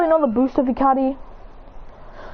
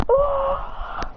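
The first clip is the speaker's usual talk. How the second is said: high cry of being hit; pained cry; 14 semitones higher than usual. Acted. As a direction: cry out as the blast lands. Trunks hit by purple blast.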